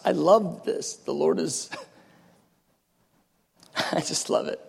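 soft breathy laugh, words half swallowed; hushed delighted laugh. Real, not acted. Preacher savouring a promise to David, hushed laugh. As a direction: laugh under your breath for joy.

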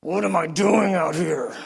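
slow grumble in prayer; grumbling. Retold or imitated. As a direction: complain to God, dragging it out. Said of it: "I'm grumbling".

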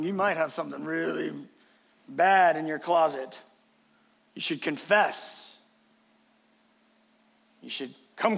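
mock accusing believer. Believers showing up to probe a sufferer's sin, low-key accusing. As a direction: imitate pious prying, keeping it low and measured.

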